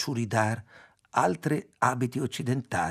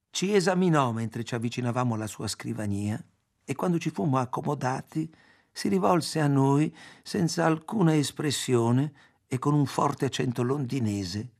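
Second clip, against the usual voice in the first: plain reading voice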